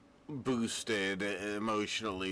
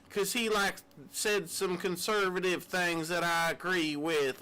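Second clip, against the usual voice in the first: mock fan voice; 6 semitones higher than usual. Mocking Charlie Kirk fans.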